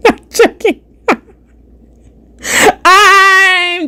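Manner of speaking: high, helpless laughing disclaimer. Real, not acted. Streamer telling viewers it was a joke, laughing high. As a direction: take it back through real laughter.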